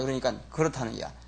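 normal talking voice

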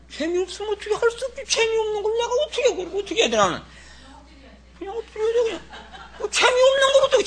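high, whining half-laughing lament; whiny complainer. Pretend. Preacher mimicking a believer whining that it's no fun. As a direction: whine like a grumbling believer. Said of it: "mocking a complaining believer".

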